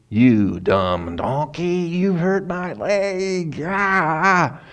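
slow mock scolding in a put-on high voice; angry at the donkey. Balaam scolding the donkey for hurting his leg, mock angry. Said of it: "Balaam started in on the donkey".

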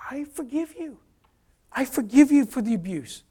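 high, tearful-sounding repeated forgiveness; emotional forgiveness. Retold or imitated. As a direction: let emotion lift the voice. Emotional forgiveness of abusive father.

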